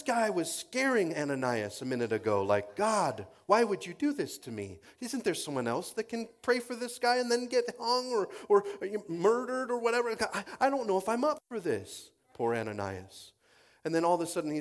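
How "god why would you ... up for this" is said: high-pitched, mildly alarmed complaining; hammed-up anxious objection; mock worried protest. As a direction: act out a reluctant man pleading with God. Voices scared Ananias protesting to God.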